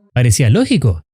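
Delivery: usual speaking voice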